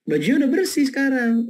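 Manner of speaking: a mildly surprised wife. Retold or imitated. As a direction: act the wife's surprise. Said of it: "si ibu tuh kaget".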